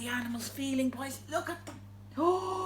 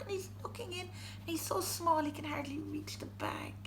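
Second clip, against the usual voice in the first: whispering; 8 dB quieter, faster than usual. A narrator's reading. Tiny mouse, whispered aside.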